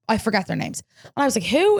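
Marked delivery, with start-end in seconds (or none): 1.4-1.8 surprised. Retold or imitated. Confused reaction retold.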